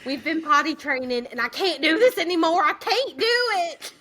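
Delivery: country Southern twang, rising into a wail; natural Southern accent. Retold or imitated. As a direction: let the Southern twang out as you despair. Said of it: "my natural is coming out".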